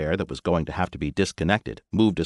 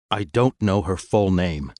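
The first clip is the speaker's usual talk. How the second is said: stiffly; slower than usual. Acted. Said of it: "said Fool stiffly".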